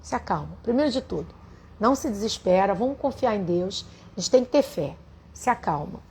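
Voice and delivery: soft, hushed reassurance; calm her down gently; soothing. Retold or imitated. Comforting a desperate friend on the phone, soft and soothing. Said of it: "Aí eu falei assim pra ela".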